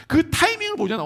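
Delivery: normal talking voice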